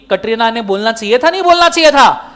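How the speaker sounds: usual speaking voice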